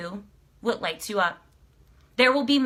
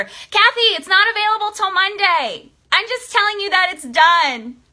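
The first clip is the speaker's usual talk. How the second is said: loud, higher, bubbling over to a viewer; a giddy, loud correction to a keen fan; excited call-out; 7 semitones higher, 5 dB louder than usual. Real, not acted. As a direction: call out to Kathy, laughing with joy. Telling a viewer the book is done.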